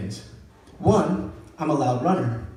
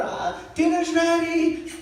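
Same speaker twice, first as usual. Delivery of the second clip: high, drawn-out grandma call; sing-out mock dinner call; 10 semitones higher, slower than usual. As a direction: call the whole family to the table. Grandma calls family to dinner.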